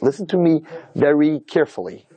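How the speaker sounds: father's measured, word-by-word warning; slow, deliberate warning. Retold or imitated. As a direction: spell it out slowly, as a stern warning. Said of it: "This is exactly how he said it".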